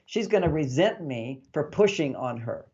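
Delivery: usual speaking voice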